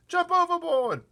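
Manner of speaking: very high, loud yelp; panicked shout. Acted. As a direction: yell the order in panic. Panicked cries on the peach.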